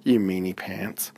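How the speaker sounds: plain reading voice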